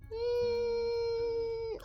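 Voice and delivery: long, high, soft thinking hum; pondering hum. Acted. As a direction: hold a high "mmm" as if deliberating. Jailer ponders the case.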